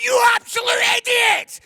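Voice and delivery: high, loud burst of road rage; furious shout. Retold or imitated. Wife in the car yelling at a driver who cut her up, furious. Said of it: "she went".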